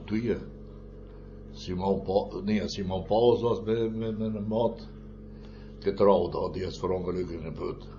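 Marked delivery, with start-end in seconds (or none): none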